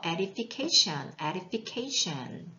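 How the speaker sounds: edification drawn out slowly; slow model word. Shown as a demonstration. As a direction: stretch out edification for the class. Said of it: "teacher repeats word".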